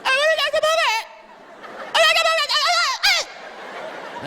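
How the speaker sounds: put-on very high squealing little-girl whine; little daughter begging. Daughter begging Daddy for a puppy, high mock squeal. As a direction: imitate a child's escalating, piercing nag. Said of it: "But my daughter, I know she's last year".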